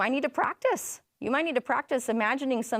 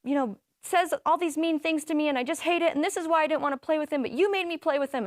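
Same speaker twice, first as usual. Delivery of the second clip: put-on higher-pitched upset kid voice; upset nine-year-old son; 6 semitones higher than usual. Mom voicing son bursting in upset, higher childlike whine. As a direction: whine it like a hurt little boy. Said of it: "he was just so upset".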